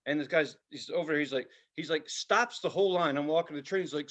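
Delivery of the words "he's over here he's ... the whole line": ordinary talk, nothing marked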